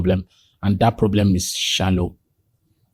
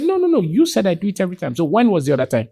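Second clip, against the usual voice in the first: insistent retort; 8 semitones higher, faster than usual. Retold or imitated. Wife challenging husband's claim in retold quarrel, insistent retort. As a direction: jump in high to cut off the claim.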